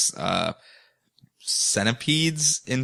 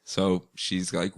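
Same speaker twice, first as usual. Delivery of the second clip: ordinary delivery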